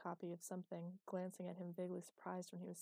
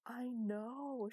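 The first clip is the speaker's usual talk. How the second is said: moaning; 5 semitones higher, 5 dB louder than usual. A narrator's reading. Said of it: "she moaned".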